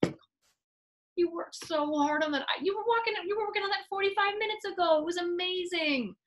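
empathetic parent. Acted. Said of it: "you say".

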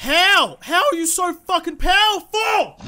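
high, loud, drawn-out disbelief; outraged shout. Real, not acted. Player beaten by the persistent crocodile, shouting in outrage. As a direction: shout in real, incredulous outrage.

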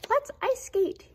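high, loud yelp; yelping girl dog. A narrator's reading. Dog Daisy yelps to Oscar, high and loud. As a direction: squeal it out like a puppy. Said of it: "yelped Daisy".

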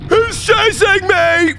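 high panicked scream, dragged out slowly; terrified screaming. Real, not acted. Player fleeing the monster up the stairs, real terrified screams. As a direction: shriek for your life as it chases you. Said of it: "that's why I'm screaming to the top of my lungs".

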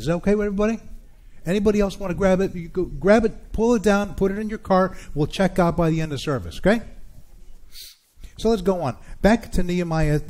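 rally the congregation from the pulpit; fired-up pastor pushing the room; preaching. Acted.

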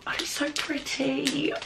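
slow, soft whisper of delight; hushed, slowed-down admiration; awed whisper. Real, not acted. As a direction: breathe it out in awe. Admiring a pretty list pad.

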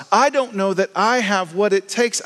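high, pleading "I don't know that I have what it takes"; anxious disciple. Retold or imitated. Disciple admitting doubt to Jesus, high and pleading. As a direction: beg it like a scared follower.

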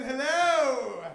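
ordinary delivery

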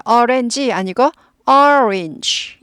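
orange said first wrong in three beats, then right in two. Shown as a demonstration. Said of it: "이거 이음절 단어예요".

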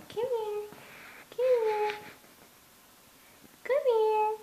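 high-pitched call. Real, not acted. Calling the pet.

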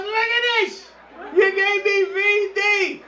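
high-pitched horrified woman's shriek; mock scream. Woman in the bathroom accusing her lover, mock screaming. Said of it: "I hear this blood-curdling scream".

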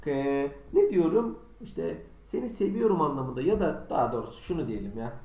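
ordinary delivery

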